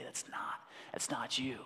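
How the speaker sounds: hushed unvoiced whisper of the enemy; whispered lie. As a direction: whisper the lie like a tempter in the ear. Enemy lying to you, whispered.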